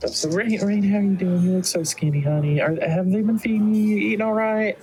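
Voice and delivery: high, doting mama voice; high-pitched motherly worry; fussing elven mother. Acted. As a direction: fret that your boy is too skinny. Game master plays Rain's mother.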